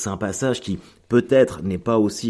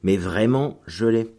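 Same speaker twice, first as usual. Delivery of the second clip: ordinary talk, nothing marked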